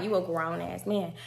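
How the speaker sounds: a light mock of "You a grown-ass man". She mocks Juvie's claim to be a grown man. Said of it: "But I was mocking him like".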